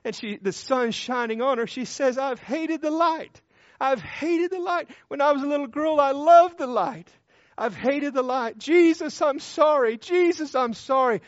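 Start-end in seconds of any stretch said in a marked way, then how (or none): 2.2-11.2 high, anguished confession, acted out; imitated crying out. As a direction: cry it out like a tearful penitent. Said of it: "Crying out to him".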